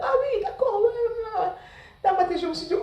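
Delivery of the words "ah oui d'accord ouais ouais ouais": high-pitched, over-polite agreement; feigned agreeableness. Retold or imitated. Student humours the classmate who mocked her, high feigned agreement. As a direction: play along in a high, mock-compliant voice.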